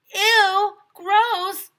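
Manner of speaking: very loud, high disgusted yell. A narrator's reading. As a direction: shriek it like grossed-out kids. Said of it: "we all yelled".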